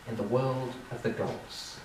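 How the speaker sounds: ordinary delivery, nothing marked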